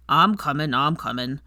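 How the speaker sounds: low gruff half-giant calling through a door; gruff Hagrid. A narrator's reading. Hagrid calling to Hermione through the door, low and gruff. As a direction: answer the knock like a big gruff gamekeeper. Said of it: "his gruff voice".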